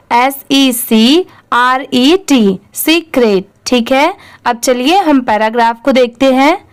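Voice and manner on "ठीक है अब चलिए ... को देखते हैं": ordinary delivery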